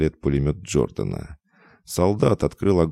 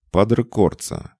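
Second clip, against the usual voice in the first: mocking call; 6 semitones higher, 3 dB louder than usual. A narrator's reading. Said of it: "слышится насмешка".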